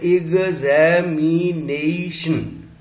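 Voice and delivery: एग्जामिनेशन slowly, broken into ig-zae-mi-nei-shun; syllable division. Shown as a demonstration. Teacher showing how many syllables it makes, syllable-by-syllable demonstration. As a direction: stretch each syllable of एग्जामिनेशन. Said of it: "इसके syllable division हमने किया".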